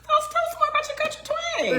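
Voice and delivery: high, fast teasing voice; teasing husband to show his twang, playful; mock eager prompt. Real, not acted. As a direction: needle him playfully.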